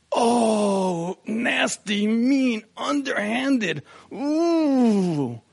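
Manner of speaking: a playful, theatrical cry of betrayal; comic despair over the lost cigars; mock anguish. Mock outrage at wife's trick.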